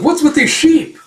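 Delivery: high, loud mock dismay; alarmed shout. Family member caught in sheep stampede, alarmed. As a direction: cry out in high-pitched alarm.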